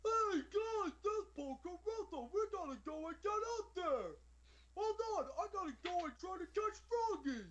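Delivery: very high, loud cartoon goofball; complete goofball. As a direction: squeal it out like a dim-witted dub henchman. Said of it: "made him sound like a complete goofball".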